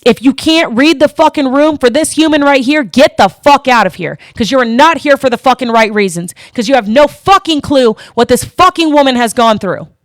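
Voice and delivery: fired-up shouting, swear words punched; real angry yelling. Real, not acted. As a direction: tell them off at full force. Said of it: "Would you like me to be fucking louder for you?".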